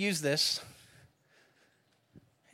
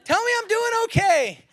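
much higher and louder, demanding praise; yelling at the GPS; 5 dB louder than usual. Pretend. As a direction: shout at Siri for reassurance. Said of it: "yelling at the G P S".

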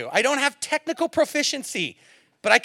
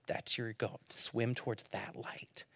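calming; 11 semitones lower, 15 dB quieter, slower than usual. Retold or imitated. Friend calming him down.

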